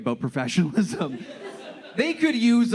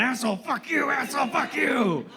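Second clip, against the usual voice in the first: higher, strained mock rant of a crazy man; deranged man ranting; 9 semitones higher than usual. Crazy man cursing right in his face, hammed-up rant. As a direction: ham up the street lunatic cursing. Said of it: "walked right up to me going".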